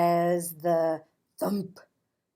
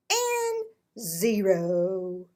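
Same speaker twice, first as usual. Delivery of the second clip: ordinary delivery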